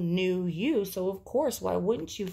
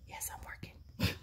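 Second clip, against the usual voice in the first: hushed, voiceless whisper; whispered aside; 11 dB quieter than usual. Real, not acted. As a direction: whisper it privately to someone nearby. Whispered aside to someone off-mic.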